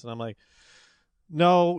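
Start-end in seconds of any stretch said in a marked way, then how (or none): 1.3-1.8 short, louder, higher flat no; refusing the newspaper billers point-blank; defiant no. Retold or imitated. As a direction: shut them down in one word.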